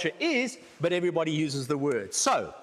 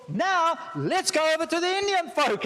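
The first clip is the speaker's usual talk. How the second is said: teasing; 9 semitones higher than usual. Acted. Lecturer teasing the class, mischievous tease. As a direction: lightly poke fun at the next group. Teasing jump to another group.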